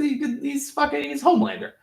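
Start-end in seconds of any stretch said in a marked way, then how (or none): none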